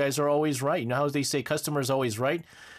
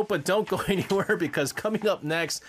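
normal talking voice

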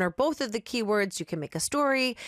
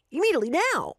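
louder voiced insistence; insistent demand; 8 semitones higher, 3 dB louder, faster than usual. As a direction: push hard, no patience. Impatient man demands now.

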